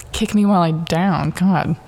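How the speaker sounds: usual speaking voice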